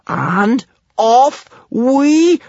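long, high, loud launching cry; drawn-out rallying shout; yelled. A narrator's reading. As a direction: yell it out, stretching every word. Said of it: "yelled Kamikaze".

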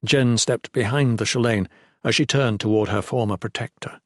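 usual speaking voice

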